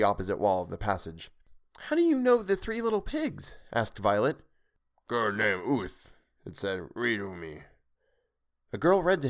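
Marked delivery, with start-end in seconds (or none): 1.8-3.6 put-on much higher curious girl's voice; a girl delighted by a surprise. Violet voiced in story. 5.1-7.6 slow broken speech of a sea creature; a monster remembering a kind girl. Acted. Creature speaks broken English.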